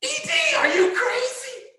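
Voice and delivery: put-on out-of-breath teacher yelling at the kid; breathless disbelieving shout; exasperated shout. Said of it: "I'm like".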